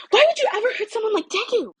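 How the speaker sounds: put-on high, loud indignant girl's voice; furious high-pitched confrontation; girl's angry challenge. Uraraka confronts Katsuki, high voice.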